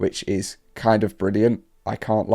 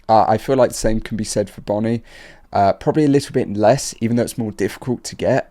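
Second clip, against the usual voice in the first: normal talking voice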